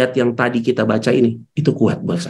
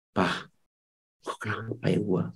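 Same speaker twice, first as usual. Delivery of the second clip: much softer, weak and confused, like half-conscious; faint dazed voice; 5 semitones lower, 9 dB quieter than usual. Retold or imitated. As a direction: barely get the words out, as if drifting. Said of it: "udah kayak halusinasi".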